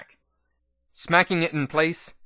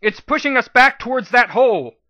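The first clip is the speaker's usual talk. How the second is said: shouting; 10 semitones higher, 7 dB louder than usual. Acted. Shouting during fight.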